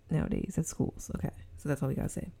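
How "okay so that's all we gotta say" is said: fast, whispered brush-off; hushed aside. Real, not acted. Podcaster hushing past a dark topic, quick whisper.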